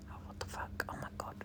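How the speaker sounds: very soft, whispered disbelief; hushed mock dismay. Patient overhearing bedside whispers, hushed dismay.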